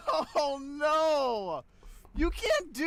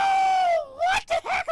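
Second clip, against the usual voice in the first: drawn-out stunned yell, very high; shocked shout; 6 dB louder, much slower than usual. Real, not acted. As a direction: yell it slowly in disbelief. Shocked at game event.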